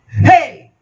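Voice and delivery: high, sudden "hey" yell; shouting. Shown as a demonstration. Demonstrator testing the mic for feedback, shouting.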